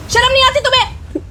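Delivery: outraged scolding; furious rebuke. Acted. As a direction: shame him to his face. Wife confronts abusive husband.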